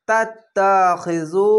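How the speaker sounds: "তাত্তাখিজু" slowly, doubled ত and long final জু; one-alif stretch. Shown as a demonstration. Teacher reciting for learners, "তাত্তাখিজু" slow, drawn out on জু. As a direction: hold the last vowel of "তাত্তাখিজু". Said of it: "এক আলিফ টেনে পড়বেন".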